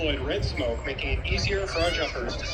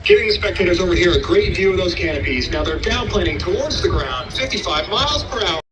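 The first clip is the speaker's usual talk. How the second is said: airshow commentator; 3 semitones higher, 13 dB louder than usual. Acted. Live airshow PA announcing.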